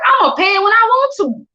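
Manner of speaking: high, cocky mock tough talk; a teasing put-on gangster brag. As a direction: play the gangster, half joking. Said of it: "Nah, I'm just playing".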